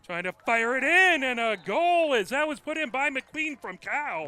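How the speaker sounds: high, loud, excited goal shout; goal call. Real, not acted. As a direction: let the goal call explode.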